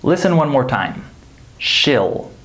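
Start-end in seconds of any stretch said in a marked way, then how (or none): 1.6-2.3 SHILL with a short lax I; weak-form SHILL; reduced contraction. Shown as a demonstration. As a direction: say SHILL with no EE sound. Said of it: "There's an I sound".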